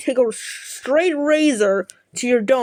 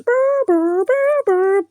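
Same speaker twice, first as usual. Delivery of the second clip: high brr sound effect, much higher; buzzing noise; 11 semitones higher, much slower than usual. Retold or imitated. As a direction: buzz like a cartoon alarm. Imitating show sound effects.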